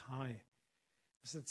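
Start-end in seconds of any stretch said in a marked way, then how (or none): 0.1-0.4 lower, softer retold greeting; a low, subdued "hi". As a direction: answer low and weakly, as if from a sickbed. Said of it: "a very low voice says".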